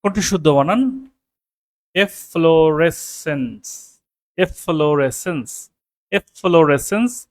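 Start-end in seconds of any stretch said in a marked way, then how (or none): 1.9-7.3 drawn out slowly, syllable by syllable; slow spelling-out. Shown as a demonstration. Teacher slowly sounding out the word for students. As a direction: stretch each syllable for students. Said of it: "আমি বানানটা আপনাদের দেখাচ্ছি".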